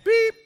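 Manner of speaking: high, loud electronic beep; imitated beep. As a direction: squeal it like a phone alarm. Phone alarm sound imitated.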